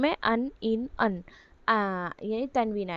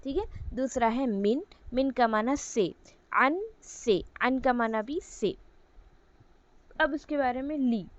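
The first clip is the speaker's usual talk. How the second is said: normal talking voice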